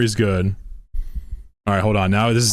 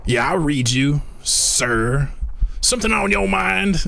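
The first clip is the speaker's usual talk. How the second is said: raised unit reply, climbing much higher; game unit voice; 6 semitones higher than usual. Acted. Streamer imitating StarCraft units, raised soldier voice. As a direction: answer like a game soldier, pitched up. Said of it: "practice my StarCraft voice lines".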